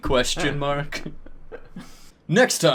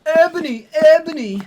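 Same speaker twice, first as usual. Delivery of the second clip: higher, louder hammed-up calling; mock sad shout; 12 semitones higher, 6 dB louder, faster than usual. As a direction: call her name like a heartbroken hero. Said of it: "shouted Draco sadly".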